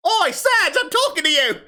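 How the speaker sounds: high, loud, hammed-up Australian accent; terrible Australian accent. Girl calling out to Serge, indignant Australian accent. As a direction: holler in a bad Aussie accent. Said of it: "Speaking of my terrible accents".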